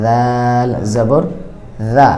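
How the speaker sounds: very slow, drawn-out দাল জবর দা; দা drawn out with a thick, heavy a; slow recitation demo. Shown as a demonstration. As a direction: spell it out slowly like a Quran teacher. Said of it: "পরিপূর্ণ আকারের মতো হবে না".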